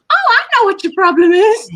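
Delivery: put-on high, loud Canadian accent know-it-all. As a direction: say it like a chirpy Canadian. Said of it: "I didn't mean to say that in a Canadian accent".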